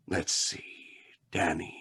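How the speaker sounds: hushed, drawing it out; whispered reveal of the name; whispered tease. Acted.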